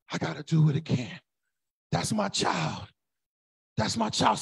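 low, soft, weary voice of God; God sighing. Retold or imitated. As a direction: sigh like a patient father.

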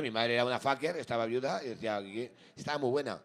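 ordinary talk

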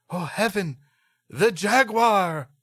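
high, loud hammed-up cry of fright; mock terror. Mother in the story gasping at the jaguar. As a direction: overact the horror of a jaguar.

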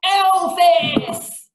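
loud, high yell of the name; crowd yell. Acted. Audience demands Elvis from the compere, yelled loud. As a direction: yell it like a whole crowd at once. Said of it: "the audience yells as one".

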